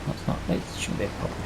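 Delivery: usual speaking voice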